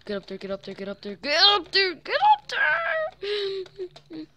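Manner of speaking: from urgent to shrieking; panicked, building to scream. Real, not acted. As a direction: panic rising to a high scream. Fleeing zombies up ladder.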